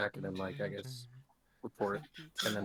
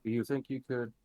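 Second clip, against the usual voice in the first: ordinary talk, nothing marked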